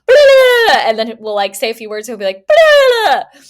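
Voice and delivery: imitating a drunk droning on, mockingly; mocking rambling. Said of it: "He just".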